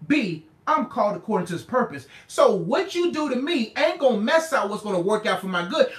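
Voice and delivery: normal talking voice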